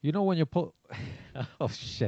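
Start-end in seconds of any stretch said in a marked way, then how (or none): 0.0-0.7 loud, raised mock-Scottish voice; attempted Scottish accent. Shown as a demonstration. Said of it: "read it in your best Scottish accent".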